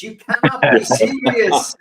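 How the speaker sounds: loud, slow, drawn-out tennis tantrum; mock tennis outburst. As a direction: bellow it like an outraged tennis star at the umpire. Repeats quote as tennis outburst.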